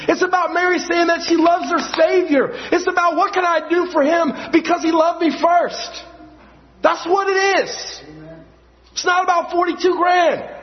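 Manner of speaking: preaching at full force. Real, not acted. Preacher exhorts the church about Mary, fervent high preaching. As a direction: drive it home to the congregation. Sermon climax on Mary's devotion.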